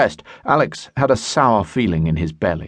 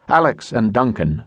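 ordinary delivery, nothing marked

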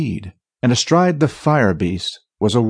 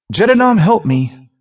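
high, alarmed cry for help; panicked plea; 9 semitones higher, 4 dB louder than usual. A narrator's reading. Lost thief calling on Jedinam, panicked plea. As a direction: plead in a raised, frightened pitch.

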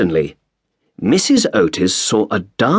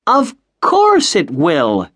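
put-on high, slow, boastful boy's cry; cried confidently; 8 semitones higher, slower than usual. A narrator's reading. Washington boasting to the housekeeper, high and drawn out. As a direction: insist brightly, stretching it out. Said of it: "cried Washington Otis".

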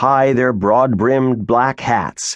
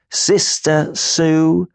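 slow, higher, stiffly correcting; stiff correction; 6 semitones higher, much slower than usual. Acted. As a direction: correct him with wounded dignity. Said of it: "Grimes corrected him stiffly".

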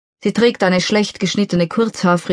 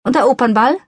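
impatient, cutting in; a touch of an impatient woman cutting in; impatient interruption; 6 semitones higher, faster than usual. A narrator's reading. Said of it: "unterbricht sie mich ungeduldig".

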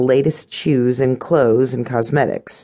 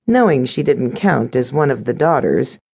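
ordinary delivery, nothing marked

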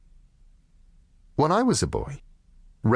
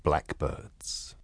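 slow, deliberate title announcement; story title read; slower than usual. A narrator's reading. Narrator opening a noir story with its title.

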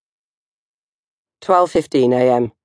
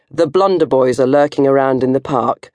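usual speaking voice

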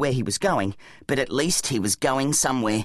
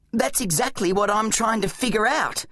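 higher-pitched, urgent explaining voice; Zack, an exasperated boy hero; 7 semitones higher than usual. Acted. Boy hero snapping at companion.

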